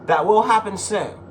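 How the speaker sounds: ordinary delivery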